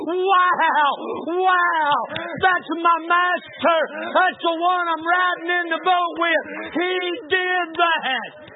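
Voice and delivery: high, loud, slow cry of wonder; awestruck shout. Acted. Preacher crying out in wonder at God, loud slow awestruck shout. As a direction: let the awe burst out, drawn long. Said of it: "look down toward the altar of God and say".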